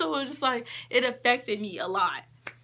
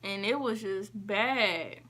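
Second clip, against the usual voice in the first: usual speaking voice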